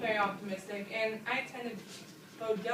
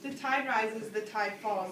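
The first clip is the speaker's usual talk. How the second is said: measured, ringing opening of a poem; poem recitation; 4 dB louder, slower than usual. Shown as a demonstration. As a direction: open the refrain clearly, with weight on "tide". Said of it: "My favorite part of the poem is definitely the first stanza".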